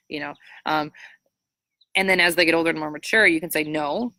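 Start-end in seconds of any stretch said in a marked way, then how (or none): none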